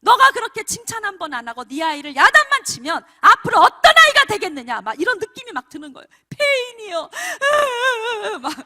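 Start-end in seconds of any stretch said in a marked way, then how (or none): 0.0-4.8 very loud, high-pitched rebuke; retold divine scolding. God warning a mother who never praises her child, thunderous scolding. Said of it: "하나님이 더 야단치시는 거예요". 6.3-8.5 loud, high retold wail; mock wailing. Mother dreading her child's ruin before God, loud acted wail. Acting out a distraught parent's dread.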